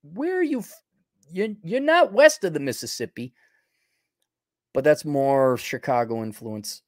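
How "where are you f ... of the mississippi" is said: put-on high-pitched Milwaukee accent; imitated Milwaukee accent, raised in pitch. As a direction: pitch it up in local Milwaukee talk. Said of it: "a lot of people in Milwaukee have this".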